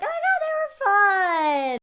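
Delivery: high, loud, slow gush; overjoyed tipsy gush, slow and loud; gushing drunk friend. Retold or imitated. Drunk friend praising the men, high loud gush.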